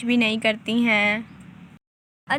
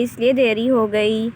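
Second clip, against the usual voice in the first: ordinary talk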